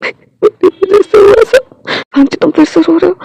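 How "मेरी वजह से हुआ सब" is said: slow, high tearful whisper of guilt; crying over the scars he caused. Acted. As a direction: sob out the blame on yourself. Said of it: "वो रोने लगता है".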